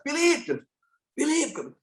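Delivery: high calling of the name from afar. Retold or imitated. Friend calling Philip from a passing minibus, high calling out. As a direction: call his name across the street. Someone calls him from a minibus.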